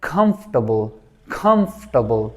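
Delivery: "कंफर्टेबल" as KUMF-tuh-bul; correct pronunciation. Shown as a demonstration. Teacher models it for students, careful three-syllable "कंफर्टेबल" as kumftuhbul. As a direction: drop the "er" from "कंफर्टेबल": kumf-tbul. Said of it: "तो ये क्या बन जाएगा?".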